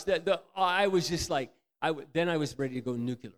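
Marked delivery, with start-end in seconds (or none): none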